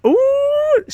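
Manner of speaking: long, high-pitched ooh; high teasing "ooh". Real, not acted. Streamer watching a girl fight brew, high teasing ooh. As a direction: hoot at the drama. Reacts to the rival's jab.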